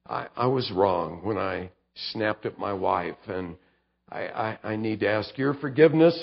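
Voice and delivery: bowed-head apology, soft; a contrite son asking forgiveness; quiet confession. Pretend. Confessing to a father figure.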